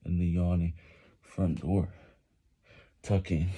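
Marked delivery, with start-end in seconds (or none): none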